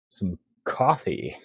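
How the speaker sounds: ordinary talk, nothing marked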